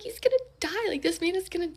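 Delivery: high, rising panic; panicked. Retold or imitated. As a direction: squeal it like he might die. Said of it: "I'm, like, freaking out".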